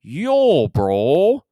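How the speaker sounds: stretched Toronto "yo" and "bro", swooping up; Toronto accent. Retold or imitated. Warehouse customer asking for machine parts, mock Toronto accent. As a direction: imitate a drawling Toronto customer. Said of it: "that was every customer".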